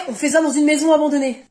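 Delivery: ordinary delivery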